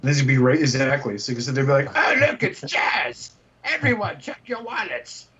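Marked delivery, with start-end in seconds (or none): 2.0-5.3 high, slow mock warning. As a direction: warn everyone in a mocking voice. Mocking voice for a toy.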